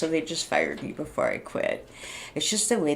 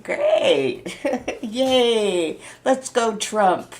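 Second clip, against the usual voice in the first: sarcastic laughing cheer; 6 semitones higher, 5 dB louder, much slower than usual. Real, not acted. Sarcastic cheer after angry rant.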